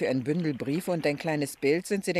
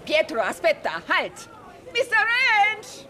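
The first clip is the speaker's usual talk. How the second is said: drawn-out high calls across the water; calling out; 12 semitones higher, 3 dB louder, slower than usual. Acted. Mrs Prest calls to Joe on the bridge, drawn-out loud hail. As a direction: hail him from the gondola, long and loud. Hails friend across the canal.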